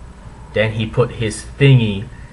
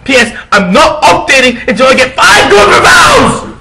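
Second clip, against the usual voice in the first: hammy stage actor; 9 semitones higher, 8 dB louder than usual. Acted. Author's note ranting at critics.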